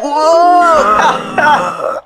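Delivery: loud high startled cry; shocked exclamation; startled whoa. Acted. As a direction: jump at the scare. Surprise scare.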